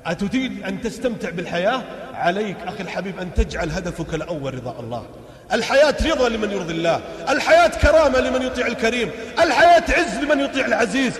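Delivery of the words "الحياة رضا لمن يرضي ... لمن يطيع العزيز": high, loud sermon cadence; fiery preaching. Acted. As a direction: preach it out to the crowd. Preaches at full force.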